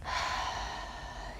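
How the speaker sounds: long, soft breath out; audible meditation exhale. Shown as a demonstration. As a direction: let the breath go in a quiet sigh. Demonstrates the out breath.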